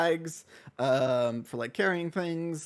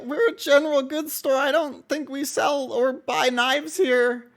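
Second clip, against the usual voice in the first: put-on much higher, louder, slow boy voice; Mortimer's shop-boy voice; 10 semitones higher, 5 dB louder, slower than usual. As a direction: correct the customer meekly. Shop boy answers customer.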